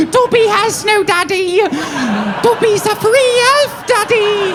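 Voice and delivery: high, loud squeaky house elf; Dobby voice. Retold or imitated. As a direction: squeak it up like a proud little elf. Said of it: "Dobby the house elf".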